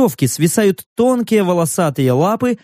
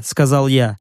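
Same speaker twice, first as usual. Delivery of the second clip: plain reading voice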